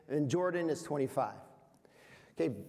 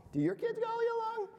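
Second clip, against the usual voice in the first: high, quick teasing disbelief; teasing question; faster than usual. Acted. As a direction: needle the audience.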